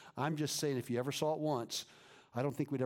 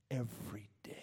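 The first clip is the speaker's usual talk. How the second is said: hushed whisper; 2 semitones lower, 3 dB quieter than usual. Acted. Daily cleansing.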